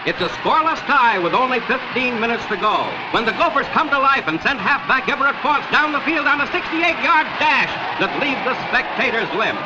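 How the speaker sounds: usual speaking voice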